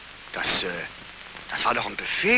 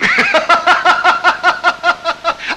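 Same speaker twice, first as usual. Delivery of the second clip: loud high guffaw; high mocking laugh; 9 dB louder than usual. Colonel laughing at Beckmann's tale, loud mocking guffaw. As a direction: laugh him off as a clown.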